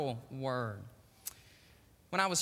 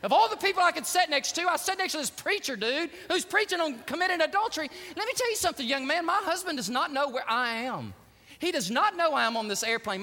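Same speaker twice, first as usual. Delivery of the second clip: upset woman; 7 semitones higher, 4 dB louder than usual. Retold or imitated. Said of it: "She goes".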